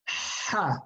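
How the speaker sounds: "Ha" as a clean breathy sound from the throat; throaty Ha. Shown as a demonstration. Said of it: "It's a clean sound coming from the throat".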